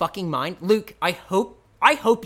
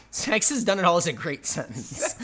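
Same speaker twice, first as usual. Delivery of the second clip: usual speaking voice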